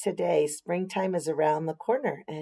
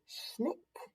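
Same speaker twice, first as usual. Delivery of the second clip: quick whispered snick; scissors snick; 6 semitones higher, 14 dB quieter than usual. A narrator's reading. Narrator voicing the scissors, crisp whispered snick. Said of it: "Her scissors say".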